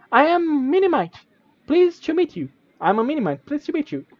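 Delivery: high chirpy fairy voice, polite; a bird-sized sprite being courteous; tiny Minimite. Acted. Minimite politely greets the traveler, high and chirpy. Game creature voice held.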